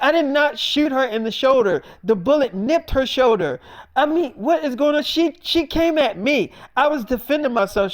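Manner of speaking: retold self-defence, high, loud and worked up; indignant denial. Acted denial to police and sister.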